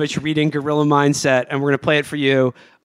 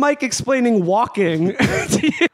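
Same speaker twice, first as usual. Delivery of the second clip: laughing; 8 semitones higher, slower than usual. Real, not acted. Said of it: "laughing summary of the clip".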